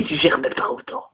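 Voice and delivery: hushed mock mother voice; mother's jab. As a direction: whisper the mother's dig. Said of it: "elle m'a fait".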